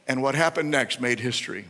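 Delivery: ordinary delivery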